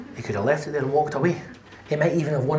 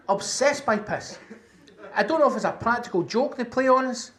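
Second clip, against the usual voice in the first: ordinary talk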